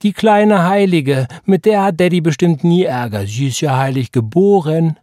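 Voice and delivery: Roy teasing Sarah, loud and high; mocking tease. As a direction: lay the mockery on thick. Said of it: "rief Roy".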